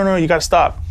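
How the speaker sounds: usual speaking voice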